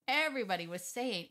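ordinary talk, nothing marked

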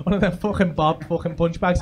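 ordinary delivery

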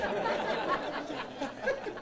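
breathy unvoiced laugh. Real, not acted. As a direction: laugh quietly at the punchline. Laughs at own joke.

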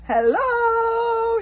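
booming brute's shout. Acted. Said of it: "shouted Thuggery the Meathead".

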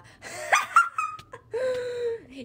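high, loud wild laughter; manic cackle. Real, not acted. Player laughing wildly while on fire. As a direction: cackle like a maniac.

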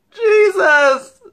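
loud sob of anguish; anguished cry. Acted. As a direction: cry out in pain. Sudden anguished outcry.

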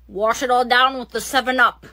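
ordinary delivery

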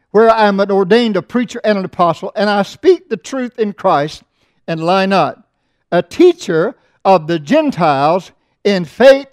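preaching the text at full voice; scripture reading. Acted. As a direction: proclaim the verse to the congregation.

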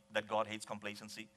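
low, quiet and quick, no emphasis; softly tossed off. Shown as a demonstration. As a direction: mumble it without conviction. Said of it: "with that tone of voice".

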